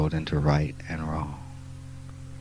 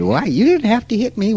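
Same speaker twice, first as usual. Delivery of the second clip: much higher, louder whiny protest; mock victim protest; 14 semitones higher, 6 dB louder than usual. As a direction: squeal it like the one just hit. Voicing a hit woman's protest.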